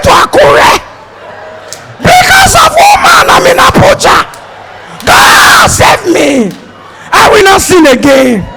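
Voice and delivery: high, loud wailing complaint to God; shouting prayer. Retold or imitated. Frightened passenger shouting at God in the falling plane, panicked yell. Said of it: "one man was shouting".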